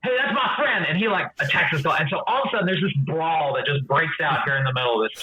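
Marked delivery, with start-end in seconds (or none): 0.0-0.9 higher, laughing yell; indignant brawler. Acted. Tavern patron protesting as the fight starts. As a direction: defend your friend. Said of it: "another guy's like".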